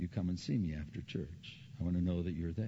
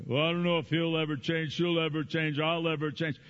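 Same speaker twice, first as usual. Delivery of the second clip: higher, louder, fast hopeless complaint; despairing believer; 7 semitones higher, 6 dB louder than usual. Retold or imitated. Discouraged believer complaining, fast and loud. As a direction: blurt the doubts in a rush.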